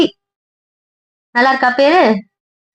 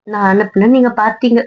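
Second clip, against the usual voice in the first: ordinary talk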